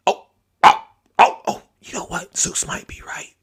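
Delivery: whispered, as a voter quietly realizing; mock whisper. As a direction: breathe it as a sheepish whispered aside.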